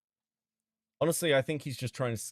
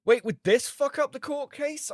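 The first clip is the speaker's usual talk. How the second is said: much higher, startled question; suddenly worried, voice jumps up; alarmed surprise; 14 semitones higher, 5 dB louder than usual. Real, not acted. Streamer fearing the sting ruins the case, alarmed. Alarmed realization about court.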